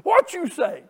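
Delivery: high loud cry of Come on; shouted amen-corner call; imitated church shout. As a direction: mimic a lady hollering from the pew. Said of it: "She'd get to shout".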